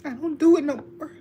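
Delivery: words tumbling out fast through real tears; tearful, rushed vow of change; crying. Real, not acted. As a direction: let the voice break into a cry as you declare it. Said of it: "I'm being all emotional".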